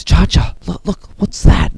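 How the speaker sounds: high, hushed-edged urgent voice of a character; alarmed calling. Acted. Yuji alerts Cha-Cha to the wall, alarmed calling. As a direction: pitch it up high, urgently getting attention.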